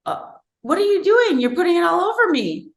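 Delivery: high, alarmed disbelief; startled. Retold or imitated. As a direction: react as if yolk just hit your shoulders. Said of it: "And I said".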